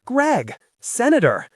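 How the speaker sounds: high, loud alarmed call; wife calling out to her husband amid the wreckage. Acted. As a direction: call out to him in shock.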